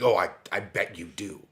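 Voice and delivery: loud, bitter sarcasm in another voice; sarcastic retort. Retold or imitated. Offended coworker scoffs at the apology, loud and sarcastic. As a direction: spit it back like you don't believe a word. Angry coworker answers the phone.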